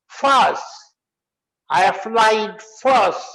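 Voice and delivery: "force" said "faas", open a, no r, slow. Shown as a demonstration. Teacher models force in a slow sentence. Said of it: "क्या बोलेंगे".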